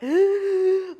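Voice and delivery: sudden loud high gasp; sharp gasp. Retold or imitated. Hearing her late father's voice, a startled gasp. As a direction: catch your breath in shock. Crying while retelling.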